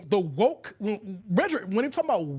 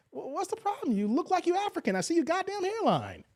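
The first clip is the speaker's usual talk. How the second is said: mocking retort; 10 semitones higher than usual. Retorting in an argument.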